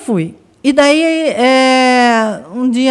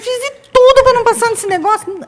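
much higher, fast mock lament; high despairing wail; 12 semitones higher, faster than usual. Sister lamenting to father that she passed, high mock wail. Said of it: "ela falou assim".

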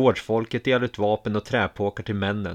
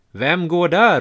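loud, much higher challenge; calling out; 8 semitones higher, 8 dB louder, slower than usual. A narrator's reading. Kråkrune calling to the strangers in the forest, loud shout. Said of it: "ropar Kråkrune".

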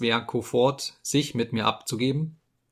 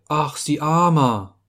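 slow, higher mock pity; 5 semitones higher, 4 dB louder, slower than usual. A narrator's reading. Sviaku mocks the late guest with slow, high fake pity. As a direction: drip fake sympathy, slowly. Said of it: "rief Sviaku spöttisch".

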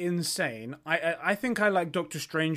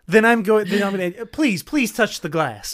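loud, raised American hero voice; slow, emphatic American movie-hero ending; American superhero movie voice; 5 semitones higher, 8 dB louder than usual. Acted. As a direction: ham up a threat in an American accent. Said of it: "American superhero movie voice".

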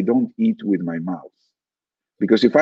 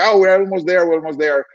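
high-pitched premature celebration; overjoyed, acted; 7 semitones higher than usual. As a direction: act giddy with the finish in sight, voice lifted. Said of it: "because you're too happy".

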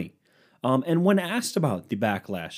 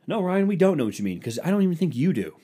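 higher, imagined heckler talking back; mock indignant retort; 6 semitones higher, 3 dB louder than usual. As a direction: cut yourself down as a fed-up listener would. Voices listeners answering back.

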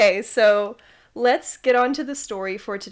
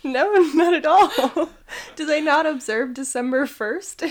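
laughing mock disbelief; 6 semitones higher, 4 dB louder than usual. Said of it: "I was like".

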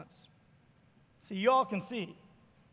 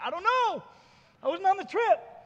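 higher, louder baffled protest; retold exasperation; 7 semitones higher, 5 dB louder than usual. Pastor replaying his reply to a friend's question, exasperated. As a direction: throw up a mock-helpless protest.

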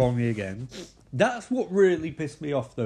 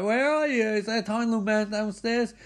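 calling out; 8 semitones higher than usual. Retold or imitated. Mike searching the house, calling out.

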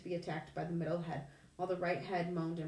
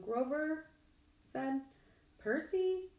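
high, hesitant satyr voice; Grover, nervous, voiced high and halting; 8 semitones higher, much slower than usual. A narrator's reading. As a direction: nervously get his attention, pitched up.

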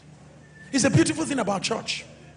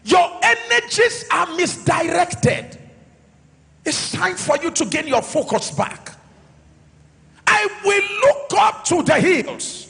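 loud, raised, emphatic sermon delivery; full-force preaching; 6 semitones higher, 4 dB louder than usual. Real, not acted. Pastor urging the church to trust God as source, preaching forcefully. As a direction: drive each phrase home to the congregation.

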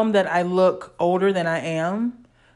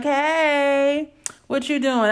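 higher, perky come-on call; 6 semitones higher, slower than usual. Retold or imitated. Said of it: "I wasn't raised to be like".

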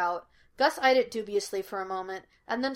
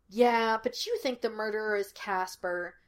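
ordinary delivery, nothing marked

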